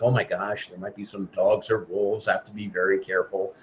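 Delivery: a big lion creeping about, mock-scared under its breath; timid fearful lion. Teacher mocking the lion who thinks he's a sheep, hushed and nervous. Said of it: "a lion that would eke around and be like".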